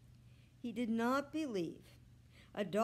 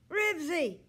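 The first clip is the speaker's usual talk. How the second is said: high loud call of the dog's name; shouting; 9 semitones higher, 10 dB louder than usual. A narrator's reading. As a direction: shout his name into the phone. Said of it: "Henry shouted again".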